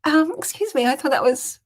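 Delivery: high, loud, feigned innocence; mock indignation. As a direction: answer dad back with playful protest. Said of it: "I said".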